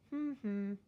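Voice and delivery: two short hums, one for each syllable; wordless two-beat hum; hummed syllables. Shown as a demonstration. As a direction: hum the word's two beats with lips closed. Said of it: "easier if they hum them".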